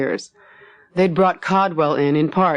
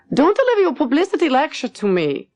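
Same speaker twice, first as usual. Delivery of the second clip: sharp, much higher snapping retort; snapped; 12 semitones higher than usual. Acted. Lottie snapping at Max over his hospital speech. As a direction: cut him off, irritated. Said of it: "Lottie snapped".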